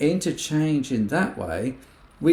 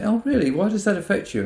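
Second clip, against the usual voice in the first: much higher, lively interested question; curious surprise; 9 semitones higher than usual. Pretend. Listener asking a friend why it upset them, brightly curious. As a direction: sound genuinely intrigued, pitch leaping up.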